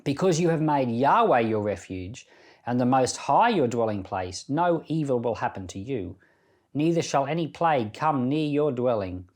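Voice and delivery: normal talking voice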